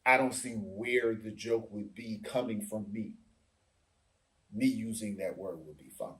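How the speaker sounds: hushed imitation of a serious comedian; dead serious. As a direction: drop the voice low and soft, stone-faced. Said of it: "looking dead serious, Seinfeld said".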